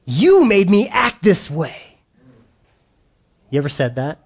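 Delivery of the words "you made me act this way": higher, slow whiny blame; mock blaming parent. Pastor mimicking a parent who blames the kids.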